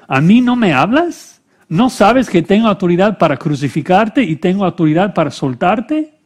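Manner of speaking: high, insistent challenge; indignant questioning. A narrator's reading. Pilate challenging a silent Jesus, indignant questioning.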